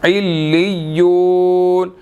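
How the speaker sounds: इल्लियून chanted slowly, with ain joined to lam and ya; slow Quranic recitation. Shown as a demonstration. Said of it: "आइन ने लाम के साथ भी मिलना है और या के साथ भी मिलना है".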